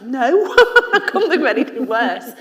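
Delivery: loud, high burst of laughter; real laughing refusal. Real, not acted. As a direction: laugh the idea away, loud. Laughing rejection of being an influencer.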